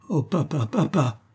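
high, pained little wail; plaintive cry. A narrator's reading. Daughter hearing that father will marry, plaintive. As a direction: lament it in a high voice. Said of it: "Le son de cette voix plaintive".

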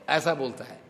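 normal talking voice

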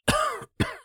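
dying man's harsh cough. Acted. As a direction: hack out a wet cough. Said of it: "Лысый откашлялся".

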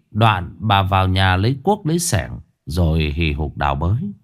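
usual speaking voice

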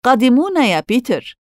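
high, eager call across a distance; bright-pitched answering holler; shouting. A narrator's reading. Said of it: "فصاحوا".

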